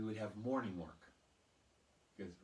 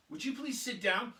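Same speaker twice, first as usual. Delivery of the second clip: higher, louder bewildered teacher; exasperated teacher; 11 semitones higher, 8 dB louder than usual. Retold or imitated. Teacher catching the boy running around, high and loud. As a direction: snap at the boy in disbelief.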